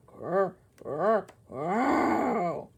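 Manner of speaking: throaty growl; rumbling stomach growl; growling noise. Acted. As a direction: growl like a beast. Growling got louder.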